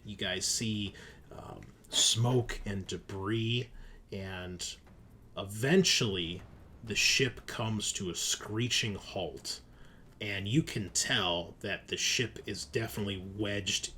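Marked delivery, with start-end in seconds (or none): none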